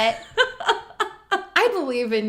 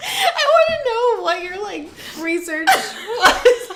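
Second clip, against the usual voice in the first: laughing; 9 semitones higher, slower than usual. Real, not acted. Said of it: "laughing at the attempt".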